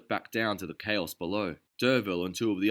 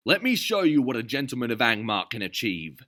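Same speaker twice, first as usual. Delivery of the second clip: Notley throwing up his hands; exasperated exclamation; 4 semitones higher, 7 dB louder than usual. A narrator's reading. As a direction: give in with huffy grandeur. Notley boasting.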